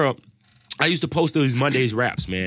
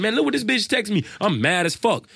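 higher-pitched, worked-up retelling; retold anger; 7 semitones higher than usual. Showing a friend the coworker's text, mock outrage. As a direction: replay being mad, voice pitched up. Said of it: "I came home mad as fuck".

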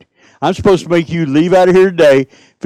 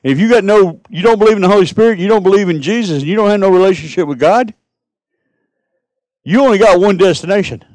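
fiery preaching; 3 semitones higher than usual. Acted. Fiery preaching about hell.

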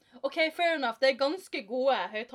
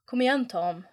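called out; 2 semitones higher, 5 dB louder than usual. A narrator's reading. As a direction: call to a friend across the room. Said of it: "ropte Bill".

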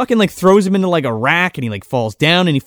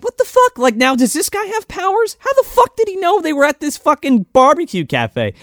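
incredulous; 10 semitones higher than usual. Retold or imitated. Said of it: "and I'm like".

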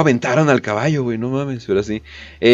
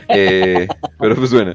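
usual speaking voice

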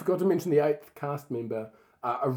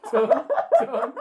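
laughing call; 12 semitones higher, 5 dB louder than usual. Real, not acted. Calling cohost while laughing.

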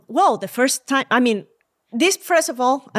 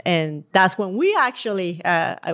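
usual speaking voice

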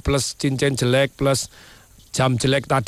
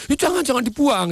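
high, urgent mock plea; acted pleading; 10 semitones higher than usual. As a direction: beg hastily to save a keepsake. Victim pleads again for the ring. (pura-pura memohon dengan nada tinggi)

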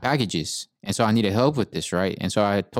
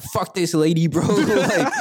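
retold cursing; 7 semitones higher, slower than usual. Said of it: "but I'm fucking cursing".

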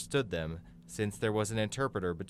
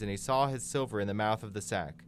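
plain reading voice

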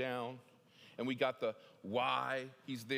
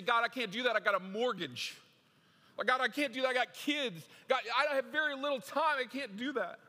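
high, loud pleading excuses to God; mock protest; 8 semitones higher, 6 dB louder than usual. Believer loudly protesting to God, pleading excuses. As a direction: whine out every excuse, rising. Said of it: "We say".

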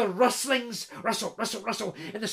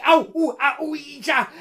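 pained yelps; 6 semitones higher, 6 dB louder than usual. Acted. Peter hurt by thorns.

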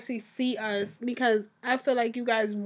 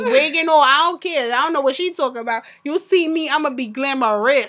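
ordinary talk, nothing marked

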